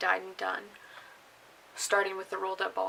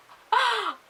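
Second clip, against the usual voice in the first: loud laugh; 12 semitones higher, 8 dB louder than usual. Real, not acted. Laughing at own laziness.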